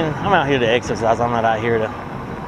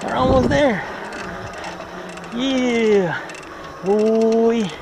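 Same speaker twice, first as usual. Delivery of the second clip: excited; 8 semitones higher, much slower than usual. Real, not acted. Cresting hill excitedly.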